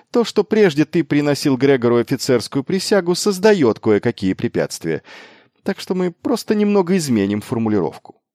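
high, animated reasoning; eager improvised justification; insistent arguing. A narrator's reading. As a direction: brush the obstacle aside with energy. Miles argues past the objection.

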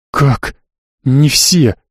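ordinary delivery, nothing marked

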